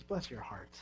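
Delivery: hushed, low bless-your-heart; sweet put-down. Shown as a demonstration. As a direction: say it softly, sugar over the sting. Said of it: "all you gotta say is".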